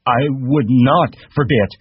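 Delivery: high, slow indignant denial; loud protest. Acted. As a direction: protest loudly like a hurt child. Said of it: "Lenny said loudly".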